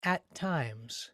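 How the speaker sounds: "At times" with equal weight on "At" and "times"; even stress. Shown as a demonstration. Teacher modeling "At times" with even beats. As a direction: give "At" and "times" the same weight. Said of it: "say it with me right now".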